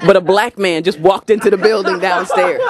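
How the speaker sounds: ordinary talk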